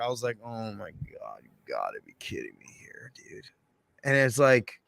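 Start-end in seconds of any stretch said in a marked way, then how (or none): 0.4-3.5 barely audible, disbelieving whisper; retold hushed exclamation; whispered to himself. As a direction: whisper it privately in dismay.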